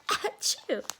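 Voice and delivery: playful fake sneeze; mock sneeze. Reader mimicking Buster's sneezing powder with a sneeze. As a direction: toss off a little pretend achoo.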